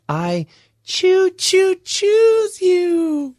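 put-on very high, drawn-out childlike voice; cartoon little kid, high and slow; Ralphie Wiggum. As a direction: squeak it out slowly, stretching every word. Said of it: "That's Ralphie Wiggum".

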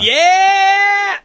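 excited cheer. Real, not acted. Cheering about gym high five.

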